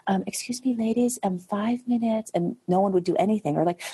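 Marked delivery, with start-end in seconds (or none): 0.1-2.3 put-on raised, hesitant little voice calling the girls; timid young captain. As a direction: politely try to get the dancers' attention, unsure. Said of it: "she was very quiet".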